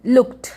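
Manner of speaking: लुक्ड ending in a crisp t, "lukt"; final D as T. Shown as a demonstration. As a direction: say लुक्ड as one syllable, "lukt". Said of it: "ट होगा". (लुक्ड को लुक्ट बोलकर)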